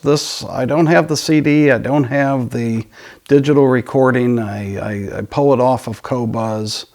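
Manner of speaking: normal talking voice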